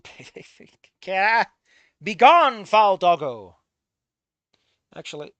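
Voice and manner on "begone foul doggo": loud, hammed-up banishing cry; mock command. Streamer playfully banishing the dog, loud mock decree. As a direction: boom it out like a cartoon knight.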